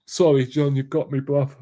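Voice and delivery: ordinary talk, nothing marked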